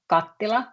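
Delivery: kattila, double t held, stress on ka; Finnish word kattila. Shown as a demonstration. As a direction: say kattila clearly, stress on the first syllable.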